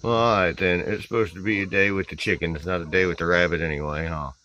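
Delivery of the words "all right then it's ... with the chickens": ordinary talk, nothing marked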